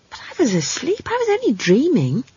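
put-on high-pitched young girl's protest; dismayed child. Girl protests she was asleep, high and dismayed. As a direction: plead innocence in a small high voice. Child alarmed at damage done.

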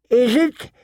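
put-on high-pitched, doubting old lord; suspicious old man. A narrator's reading. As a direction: challenge her in a high old man's voice. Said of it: "squinted at her suspiciously".

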